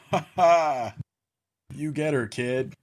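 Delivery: mock hearty laugh, then egging on; adult man laughing. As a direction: mimic a piped-in man's "ha ha". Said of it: "it was one adult man laughing".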